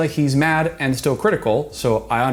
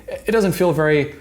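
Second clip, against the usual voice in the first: usual speaking voice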